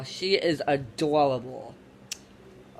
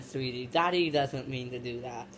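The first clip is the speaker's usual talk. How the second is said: soft, tender apology; gentle dad voice; 6 semitones lower, 7 dB quieter than usual. Acted. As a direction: speak softly to the baby. Father apologizes to the baby.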